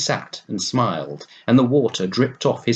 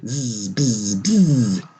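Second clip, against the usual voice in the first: mocking the bee's buzz; 2 semitones higher, much slower than usual. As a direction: throw the bee's buzz back at her crossly. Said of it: "come in at a window and say".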